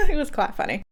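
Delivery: ordinary talk, nothing marked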